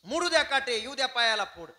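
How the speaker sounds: high, loud remonstrance; loud protest. Acted. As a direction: raise the voice high in protest, then ease slightly. Acted protest about thorns and blisters.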